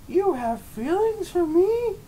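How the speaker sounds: squeaky puppet, taken aback; surprised puppet voice. Pretend. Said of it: "do a puppet show".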